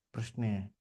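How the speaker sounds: normal talking voice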